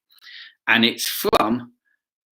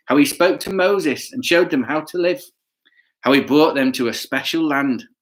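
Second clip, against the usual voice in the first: put-on lively storybook narration to kids; reading to children; 6 semitones higher than usual. A narrator's reading. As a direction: tell it like a picture book for children.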